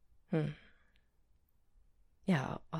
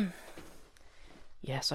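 ordinary talk, nothing marked

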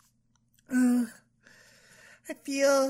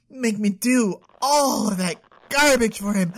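ordinary delivery

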